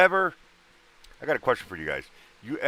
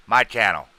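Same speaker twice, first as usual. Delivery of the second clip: emphatic; 3 semitones higher, 11 dB louder than usual. Real, not acted. Host insisting on his channel.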